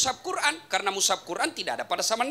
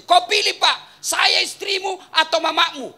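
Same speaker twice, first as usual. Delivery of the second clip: higher, louder, slow whiny wife; shrill wife ultimatum; 8 semitones higher, 9 dB louder, slower than usual. Retold or imitated. Wife makes husband choose her or his mother, higher and loud. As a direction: demand it like an angry wife.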